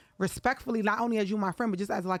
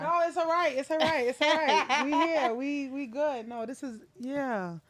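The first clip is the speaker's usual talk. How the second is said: reassuring comfort; 5 semitones higher than usual. Real, not acted. Host comforts guest starting to cry.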